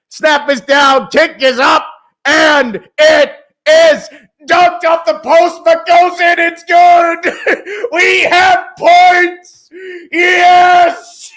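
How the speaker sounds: yelled, high-pitched kick call; excited shout. Real, not acted. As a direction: scream it as the kick goes in. Kick good, fan yells.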